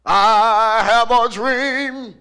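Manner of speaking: very loud, slow oratory; long-held words, booming orator; Martin Luther King. Retold or imitated. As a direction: ring it out to a huge crowd. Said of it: "When people talk like that".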